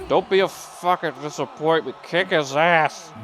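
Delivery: high, loud mock scolding voice, stretched at the end; hammed-up order from a disappointed mentor; Lou Thesz. Imitating a mentor urging on Takada, high mock scolding. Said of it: "Takada hears Lou Thesz say".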